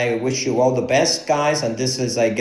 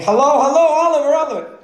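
high mom voice calling out; mother's voice; 12 semitones higher, faster than usual. Retold or imitated. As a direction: mimic mom calling her son. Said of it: "and she starts saying".

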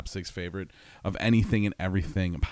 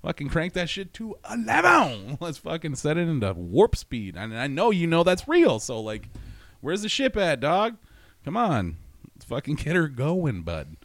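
higher hyped party-bro voice; frat bro; 7 semitones higher, 4 dB louder than usual. Acted. Acting hyped alien adventure.

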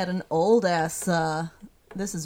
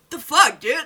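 indignant Death; 8 semitones higher, 9 dB louder than usual. Retold or imitated. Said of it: "Death was like".

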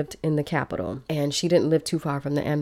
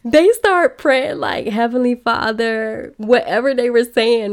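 laughing, high-pitched retelling; 7 semitones higher, 6 dB louder than usual. Retold or imitated. Imitating the prayer moment, high.

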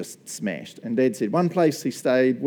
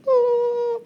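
high panicked wail of dread; mock horror squeal; anxious wail. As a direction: let out a squealing dread cry. Dread of hosting meals.

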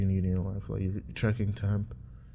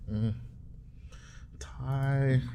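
ordinary talk, nothing marked

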